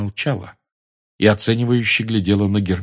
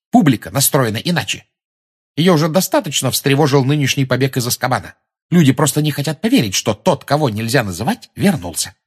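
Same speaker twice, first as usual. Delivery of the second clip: brisk, matter-of-fact explanation, pitched higher; higher, practical, no-nonsense delivery; businesslike; 6 semitones higher, 4 dB louder than usual. A narrator's reading. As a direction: lay out the facts like business. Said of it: "заговорила деловито".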